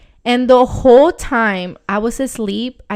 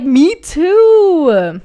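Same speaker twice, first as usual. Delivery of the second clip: higher, louder blurted me too; retold shocked agreement with her friend; 7 semitones higher, 9 dB louder than usual. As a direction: pop it out in alarm.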